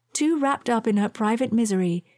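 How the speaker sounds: ordinary delivery, nothing marked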